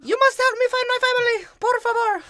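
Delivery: very high, loud falsetto begging; caricatured girl's squealed plea; mock pleading girl. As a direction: shriek it as a hammed-up damsel in distress. Mimics the Mexican girl's plea.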